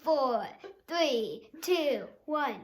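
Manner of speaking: loud playful countdown. Real, not acted. Child giving the grown-up five seconds, loud countdown. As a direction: count it down like a game show. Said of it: "You get five seconds".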